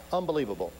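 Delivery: ordinary talk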